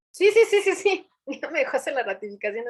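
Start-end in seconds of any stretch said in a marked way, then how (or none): 0.1-1.1 much higher and louder, rapid delighted yeses; bubbling over with eager agreement. Retold or imitated. Official eagerly agreeing to let her ratify, excited high yeses.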